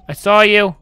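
sudden loud call at a shadow; startled shout. Real, not acted. Gamer yelling at a figure that appeared. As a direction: blurt it out loud, spooked. Spots figure in horror game.

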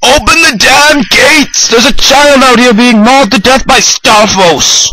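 loud mock desperate plea; shouting. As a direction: yell up at the guards. Mock pleading to guards.

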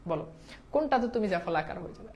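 normal talking voice

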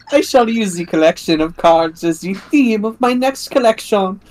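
put-on light French accent, slow. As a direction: ham up the French designer. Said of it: "give me a French character".

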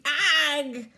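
sudden loud high yelp; shriek. Acted. George shrieking as his false teeth fly out, loud yelp. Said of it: "George shrieked".

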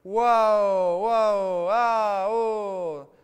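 very loud, high, long war cries; madman's prolonged battle yells; warrior howls. A narrator's reading. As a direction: let out wild howls like a warrior. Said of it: "urli forti e prolungati di un guerriero".

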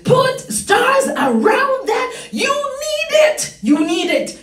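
high, emphatic preacher with stretched key words; fired-up preaching. Real, not acted. Preacher urging congregation to underline it, fired-up preaching. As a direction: drive the verse home with rising fervour.

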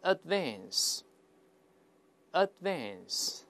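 "advance" with a short A as in cat, D held unreleased; slow model reading of "advance" as ad-VANS; American pronunciation. Shown as a demonstration. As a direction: say "advance" with American AE, pausing between repeats. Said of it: "按照美音我们再大声来一遍".

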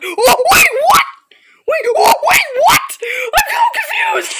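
high, loud confused shrieks; panicked shrieking. Real, not acted. Gamer panicking at the monster, shrieking.

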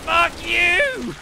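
loud, high angry yell; angry shout. Real, not acted. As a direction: curse at the enemy. Fighting enemy.

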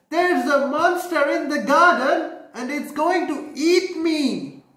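put-on high, loud frightened-boy warning; child running in to warn his mother; alarmed child. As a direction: blurt it out like a scared little kid. Bernard warning of a monster.

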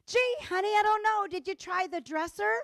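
high, lively, helpful suggestion; nice, non-judgmental tone. Shown as a demonstration. Wife helping husband find glasses, non-judgmental tone. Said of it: "non-judgmental tone".

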